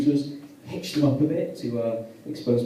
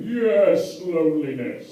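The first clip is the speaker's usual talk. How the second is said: put-on old man; 5 semitones higher, 6 dB louder, much slower than usual. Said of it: "very old man at the back going".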